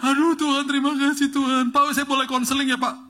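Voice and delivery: overwhelmed driver gushing and half-sobbing; hammed-up crying driver. Driver weeping to the preacher he just recognized, mock-sobbing. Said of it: "Dia nangis tiba-tiba".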